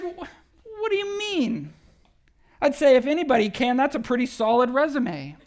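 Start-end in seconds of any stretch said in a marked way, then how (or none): 0.0-1.7 much higher, squeaking in disbelief; incredulous. Retold or imitated. Preacher reacting to the father's doubt, high incredulous squeak. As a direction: squeak out the question in mock shock. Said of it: "And I'm like".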